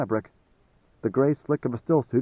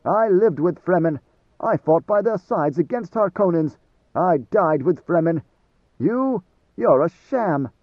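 higher and louder, slowing hard at the end; angry denunciation; 4 semitones higher, 5 dB louder than usual. A narrator's reading. Idaho denouncing Garoon as a fake Fremen, angry and loud. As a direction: hammer each claim home with contempt.

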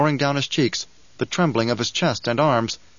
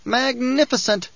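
chortling; 11 semitones higher than usual. Acted. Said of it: "Bejas chortled".

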